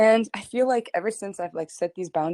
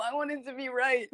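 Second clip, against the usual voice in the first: laughing; 5 semitones higher, 5 dB quieter than usual. Real, not acted. Laughing while protesting.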